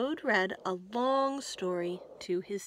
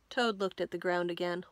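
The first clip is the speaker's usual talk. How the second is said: plain reading voice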